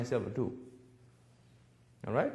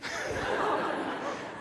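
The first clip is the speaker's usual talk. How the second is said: soft laugh; 5 dB quieter than usual. Real, not acted. Laughing at guards' reply.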